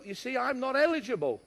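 usual speaking voice

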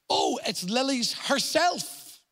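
"Oh, it's Lily's herself" drawn out slow and high, underlining who it is; emphatic announcement. Shown as a demonstration. Irish way of announcing who it is, emphatic "Oh, it's Lily's herself". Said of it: "underline the fact it's Lily's".

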